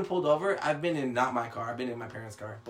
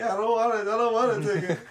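mock whine; 9 semitones higher, 6 dB louder than usual. Whining to his uncle.

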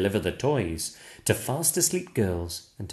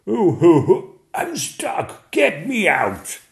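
booming shout for help; 8 semitones higher, 10 dB louder than usual. Acted. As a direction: bellow to be let out. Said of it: "then turns to a shout".

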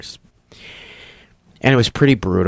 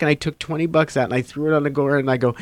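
usual speaking voice

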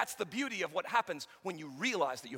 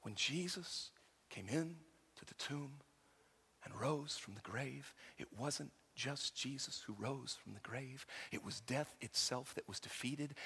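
hushed preaching; 5 semitones lower, 5 dB quieter than usual. Acted. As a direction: draw them in quietly. Soft, slow sermon passage.

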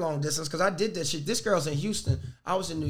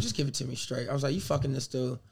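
softer, lower, just give it to me; hushed, level demand; quiet plea for the truth; quiet and serious; 7 semitones lower, 8 dB quieter than usual. Retold or imitated.

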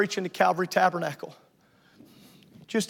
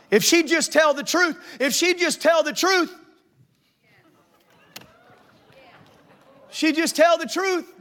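raised, pleading complaint; higher, whining about someone else; mock whining churchgoer; 8 semitones higher, 4 dB louder, slower than usual. Mimicking a churchgoer blaming others, higher.

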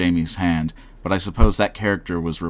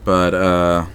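ordinary talk, nothing marked

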